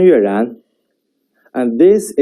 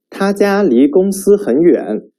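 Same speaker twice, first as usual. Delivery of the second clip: 他家离公司很远 said high, lifting at 远; rising question tune; 7 semitones higher than usual. Shown as a demonstration. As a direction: ask it, raise 远. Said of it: "pay attention to the intonations".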